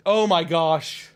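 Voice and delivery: usual speaking voice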